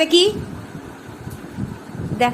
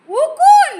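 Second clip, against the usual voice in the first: loud, high shocked question; startled cry on finding lice; 11 semitones higher, 9 dB louder, much slower than usual. Real, not acted. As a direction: blurt it out in disbelief.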